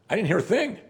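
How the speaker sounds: voiced high, playing dumb; mock innocent denial.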